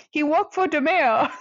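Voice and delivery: thick mock Boston accent, R's dropped. Shown as a demonstration. Joking that he works for the mayor, Boston accent. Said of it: "but with a Boston accent".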